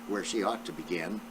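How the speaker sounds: plain reading voice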